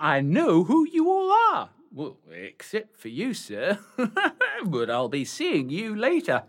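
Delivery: high, hammed-up pantomime dame; panto dame. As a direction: play the Widow Twanky to the crowd. Old man playing panto dame.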